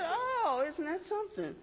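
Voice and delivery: high, mock-amazed sarcasm; sarcastic. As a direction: fake surprise at his jab. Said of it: "I said".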